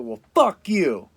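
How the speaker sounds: loud, slow mock snap; snapping at someone. Tired man snapping at someone who bothers him, loud and slow. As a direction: bite back with a slow, loud fuck you. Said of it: "when I've stayed up in the past".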